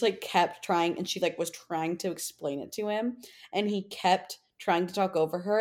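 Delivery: ordinary delivery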